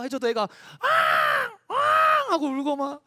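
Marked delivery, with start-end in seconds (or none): 0.4-2.3 put-on high wailing baby cry; imitated infant crying. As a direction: wail like a newborn.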